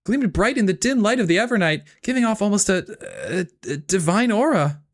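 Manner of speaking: high anime hero narration, eager and earnest; Deku English impression. Streamer narrating the visual novel as Deku, high eager dub voice. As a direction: narrate like a nervous dub hero, pitched well up. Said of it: "with a Deku English impression".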